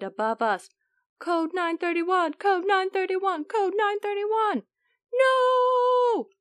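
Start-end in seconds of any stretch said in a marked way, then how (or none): 1.2-4.6 urgent alarm call. Acted. Germ sounds an alarm. 5.1-6.3 very high, loud panicked no; squeaky germ voice. Acted.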